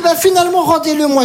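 ordinary talk, nothing marked

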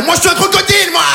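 high squeaky shouted cartoon croc; crocodile voice. Comic voicing the faded jogging crocodile, high shouted.

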